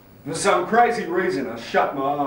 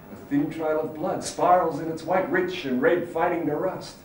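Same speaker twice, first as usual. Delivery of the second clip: usual speaking voice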